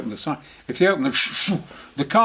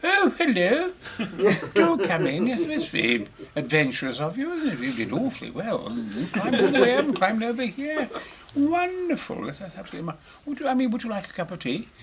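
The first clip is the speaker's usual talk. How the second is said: high, posh-sounding mock Queen voice; mimicking the Queen; 6 semitones higher than usual. Imitating the Queen welcoming the intruder, high and gracious. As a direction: play the Queen, all gracious surprise.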